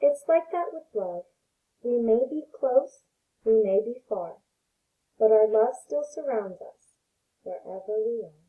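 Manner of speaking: gentle read-aloud voice for little ones; storybook reading. Acted. Teacher reading a picture book to her pre-K class, gentle storytelling.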